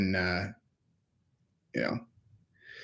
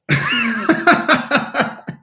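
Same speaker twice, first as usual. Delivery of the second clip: long high laugh; 12 semitones higher than usual. Real, not acted. Laughing high at his own mock video title. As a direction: crack up at your own joke.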